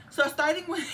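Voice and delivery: breaking into laughter; cracking up mid-sentence; laughing. Real, not acted. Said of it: "let's get my voice".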